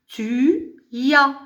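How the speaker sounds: "tu y as" slowly, glide on "y as"; liaison marked. Shown as a demonstration. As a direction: stretch "tu y as" into linked teaching pieces. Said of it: "Il faut marquer la liaison".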